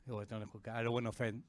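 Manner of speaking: normal talking voice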